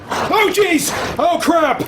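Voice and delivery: sudden high frightened shout; startled yelp. Real, not acted. Jump scare in horror game.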